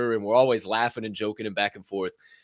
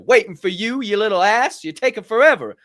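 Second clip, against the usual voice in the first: lightly voiced teasing older woman; 8 semitones higher, 7 dB louder than usual. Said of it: "She said".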